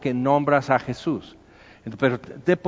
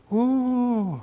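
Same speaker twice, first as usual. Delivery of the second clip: mocking gossip; 10 semitones higher than usual. Gossip mocking the new believer.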